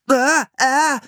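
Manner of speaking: high, loud startled yelps; a boy caught off guard by a wriggling eel; cry of shock. A narrator's reading. Philipp grabs a slimy eel, high startled cries. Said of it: "schrie er".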